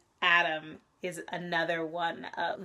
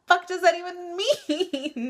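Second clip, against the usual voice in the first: high, loud, laughing through the question; baffled laugh at a weird last line; laughing disbelief; 11 semitones higher, 5 dB louder than usual. Retold or imitated. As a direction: crack up mid-line in disbelief. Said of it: "It's just like".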